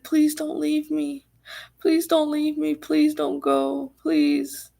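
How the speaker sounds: high, drawn-out mock pleading; desperate begging. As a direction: imitate someone theatrically begging. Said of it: "being desperate, like, begging".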